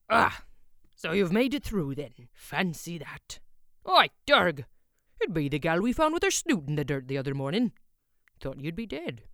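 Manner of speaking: put-on loud, hearty old smith; hearty blacksmith. A narrator's reading. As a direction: call out across the forge. Blacksmith greets visitor.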